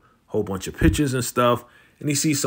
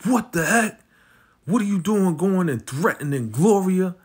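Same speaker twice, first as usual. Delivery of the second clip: higher-pitched, indignant demand; mock confrontation; 7 semitones higher than usual. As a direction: imitate someone storming in to demand answers. Retells Vic confronting Walter.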